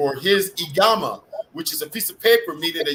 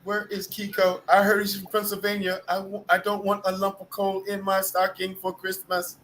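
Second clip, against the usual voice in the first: higher-pitched put-on Thai girl voice; mock Thai accent; 4 semitones higher than usual. Teasing an absent friend about Christmas, mock Thai girl accent. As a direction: keep up a playful imitation of a Thai girl. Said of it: "Can I say it like a Thai girl?".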